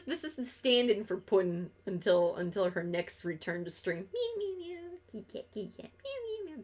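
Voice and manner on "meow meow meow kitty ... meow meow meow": cooing at the cat. Real, not acted. Streamer coos to her cat on stream, soft high baby talk. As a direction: coo over the cat, meowing at it.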